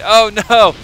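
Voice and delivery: sudden loud laughing oh no; shocked laughing outcry. Real, not acted. Caster sees siege tanks wiped, loud laughing dismay. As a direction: blurt it out as the tanks die.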